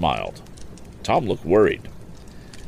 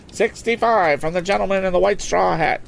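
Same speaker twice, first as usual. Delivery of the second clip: high, brisk auctioneer selling a boat; auctioneer's pitch. Acted. Auctioneer coaxing bids from the lakeside crowd, lively and high.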